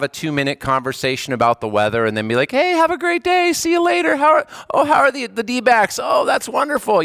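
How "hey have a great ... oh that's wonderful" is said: high-pitched, mock-cheery chit-chat; put-on high voice for polite small talk; breezy small talk. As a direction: act out a breezy, superficial hello and goodbye.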